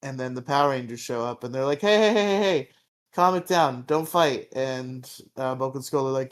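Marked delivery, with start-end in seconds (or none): 1.8-4.5 loud, high call to stop a fight; hollering to break it up. Retold or imitated. Rangers shouting to break up a brawl, loud and high.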